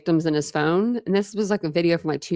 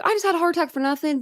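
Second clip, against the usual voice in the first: exasperated relief; 10 semitones higher, faster than usual. Retold or imitated.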